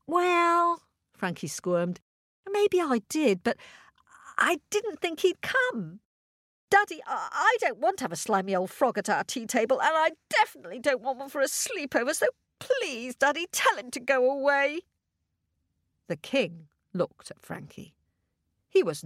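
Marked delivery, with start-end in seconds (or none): 0.1-0.8 high, squeaky little princess; a sulky little princess voice; cartoon princess. Princess answers her father. 2.5-14.8 high, pleading, quick little princess; cartoon princess. Princess pleads with her dad.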